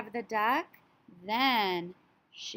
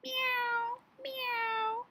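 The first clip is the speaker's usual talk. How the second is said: cat meow; 14 semitones higher than usual. Acted. Teacher meowing as the cat for her class, very high. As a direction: mew like a cat. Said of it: "chase the cat".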